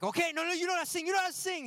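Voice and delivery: high, hurried panicky teacher; mock panic. Teacher calling off a crying pupil's solo, panicking. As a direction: backpedal in a high-pitched fluster. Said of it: "She starts panicking".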